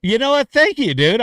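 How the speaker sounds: high, loud burst of delight; real excited squeal of thanks. Real, not acted. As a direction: yelp it out, thrilled. Thrilled reply to compliment.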